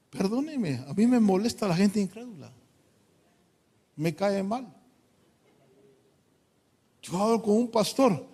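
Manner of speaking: normal talking voice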